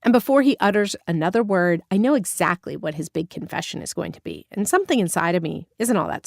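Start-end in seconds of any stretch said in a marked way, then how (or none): none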